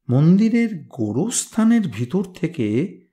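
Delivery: ordinary delivery, nothing marked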